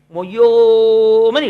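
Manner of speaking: loud, long-drawn mock bawl; mock wail on one long held note; imitated child's wail. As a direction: imitate a little boy howling, holding the vowel. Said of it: "ఏడుస్తాడు".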